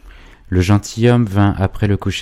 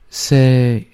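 blushing; 7 semitones higher, 4 dB louder than usual. A narrator's reading. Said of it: "dit-elle en rougissant".

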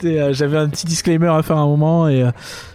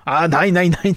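hammed-up German accent; over-the-top German banker accent. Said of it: "pas d'accent allemand problématique". (accent allemand exagéré)

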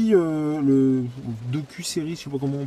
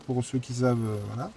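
trailing off; 7 semitones lower, 8 dB quieter than usual. Real, not acted. Aside fading out after a reference.

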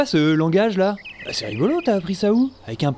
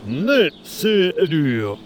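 very slow; 2 semitones lower, much slower than usual. Acted. Friend answers slowly.

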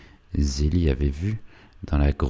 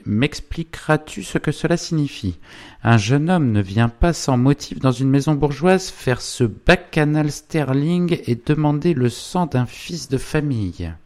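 higher-pitched indignant wife; Zélie; 11 semitones higher, 4 dB louder than usual. Acted. Wife asks husband what this means, indignant. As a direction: demand answers with pitch held up.

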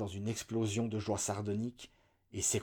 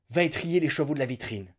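plain reading voice

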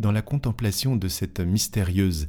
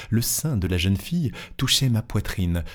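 ordinary delivery, nothing marked

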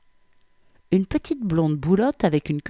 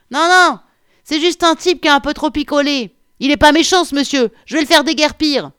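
very high, loud woman shouting to a colleague; calling back; 11 semitones higher, 9 dB louder than usual. A narrator's reading. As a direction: holler back to keep him away. Policewoman calls up to her colleague. (crier vers le haut, voix très aiguë)

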